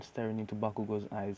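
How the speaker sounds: usual speaking voice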